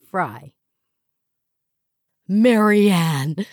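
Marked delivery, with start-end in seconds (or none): 2.3-3.5 loud, rising squeal of the name; old friend spotting Marianne, squealing; delighted squeal. Acted. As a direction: call across the room, overjoyed. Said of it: "a delighted voice squealed".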